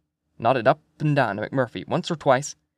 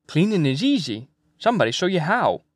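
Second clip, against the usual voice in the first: put-on lilting, higher old seaman; old Swede fisherman, reassuring; 5 semitones higher than usual. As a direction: make it sound easy, in a fisherman's lilt.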